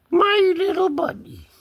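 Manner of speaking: high-pitched pet talk; high cooing. Pretend. Owner doting on the cat, high-pitched cooing. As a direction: coo it up high.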